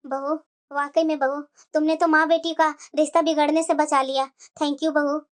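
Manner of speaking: put-on relieved elderly mother; grateful mother-in-law. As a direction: thank the bahu warmly. Mother thanks daughter-in-law.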